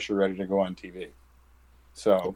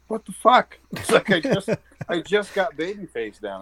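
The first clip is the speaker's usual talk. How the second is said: mock disbelief; 10 semitones higher than usual. Own shocked reaction to heel assignment.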